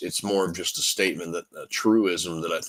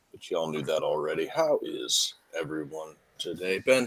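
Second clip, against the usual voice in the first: ordinary talk, nothing marked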